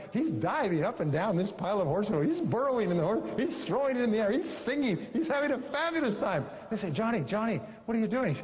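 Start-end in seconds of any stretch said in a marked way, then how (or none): 0.1-6.4 climbing higher and higher with delight; rising excitement. A narrator's reading. Telling how Johnny plays in the manure, building to gleeful high pitch. 6.9-8.3 put-on higher, calling a child across the garage. Parents finding Johnny in the manure, higher, alarmed calling.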